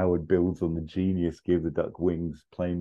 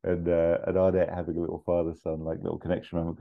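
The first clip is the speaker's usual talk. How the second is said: ordinary talk, nothing marked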